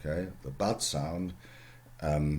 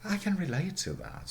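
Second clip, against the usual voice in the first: ordinary talk, nothing marked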